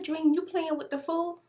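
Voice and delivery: high, pointed angry question; retold angry confronting. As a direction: call out her son in disbelief. Confronting son Dream about fool.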